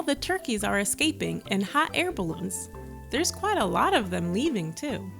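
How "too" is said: ordinary delivery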